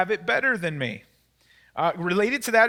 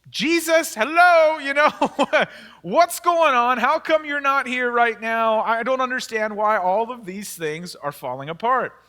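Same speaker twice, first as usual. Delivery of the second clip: exasperated call to Jesus; 4 dB louder than usual. Retold or imitated. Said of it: "You're like".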